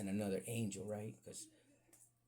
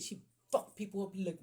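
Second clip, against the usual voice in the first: usual speaking voice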